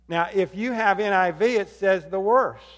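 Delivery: normal talking voice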